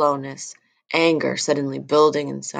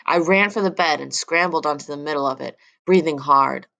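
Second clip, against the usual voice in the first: ordinary delivery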